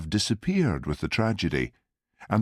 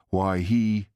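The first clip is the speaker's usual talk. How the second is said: ordinary delivery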